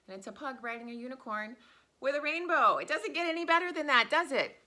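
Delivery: high, loud, fast delight; excited gushing. Real, not acted. Excited about the new t-shirt.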